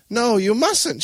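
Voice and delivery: high, loud protest; yell. A narrator's reading. Grandmother forbidding her grandson, alarmed yell. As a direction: yell to stop him going. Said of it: "she yelled".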